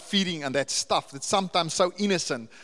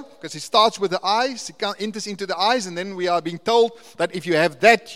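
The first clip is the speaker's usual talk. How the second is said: louder, rising sermon climax; full-force preaching; 3 semitones higher, 9 dB louder than usual. Acted. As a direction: preach it out to the congregation, building.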